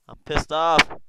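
loud annoyed repeat. Real, not acted.

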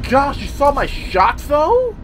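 high, slow thrilled exclaim; delighted disbelief; excited. Real, not acted. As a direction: marvel at your own shots. Brags about shots.

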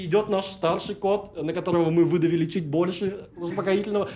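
ordinary delivery

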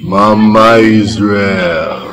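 slow, stretched-out greeting call; drawn-out hail. Acted. Man hailing Mama Israel, drawn-out hail. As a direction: draw the name right out. Hailing the food seller by name.

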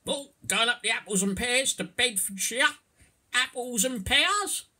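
very high, loud Cockney character; broad Cockney accent. Shown as a demonstration. Teacher acting out slang for the learner, broad Cockney. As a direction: play a hammed-up Cockney. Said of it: "I'll do the first bit in Cockney".

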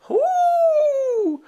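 very high howling call. A narrator's reading. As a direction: howl to gather the kids. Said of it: "by howling".

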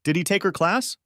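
mild shout, high-pitched question. A narrator's reading. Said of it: "shouted I".

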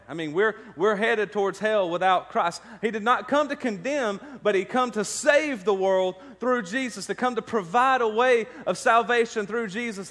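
high, loud preaching at full force; impassioned preaching. Real, not acted. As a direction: drive the message home, pitched high and loud.

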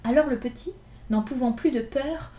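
ordinary delivery, nothing marked

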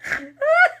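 high squealing snort of a laugh; real high snort laugh. Real, not acted.

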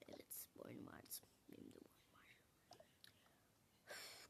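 soft unvoiced whisper; whispered aside. Real, not acted. Daughter whispering to her mother during the call, hushed. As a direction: whisper it so the caller won't hear.

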